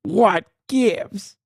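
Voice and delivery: mock Midwestern stop, what gives; teasing impression of the candidate; imitating Stevens. Host in a dream, in the candidate's Midwestern accent. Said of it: "and I say".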